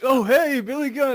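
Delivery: high-pitched, surprised hello, starting loud; acted-out starstruck greeting; startled greeting. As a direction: greet a celebrity you just bumped into. Startled greeting to a famous wrestler.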